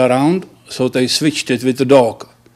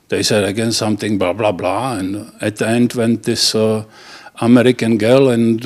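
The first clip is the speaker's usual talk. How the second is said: ordinary talk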